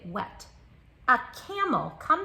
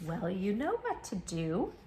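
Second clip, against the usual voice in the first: ordinary delivery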